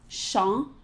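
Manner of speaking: champ with a dark nasal ah, no p; French nasal champ. Shown as a demonstration. Singing teacher modeling champ, dark nasal ah. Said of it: "So say it with me".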